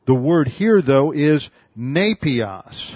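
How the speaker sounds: slow down and land hard on "nepios"; emphatic contrast. Shown as a demonstration. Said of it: "draws contrast with brephos".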